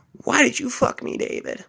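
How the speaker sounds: mock hysterical girl. Girl's quote continues.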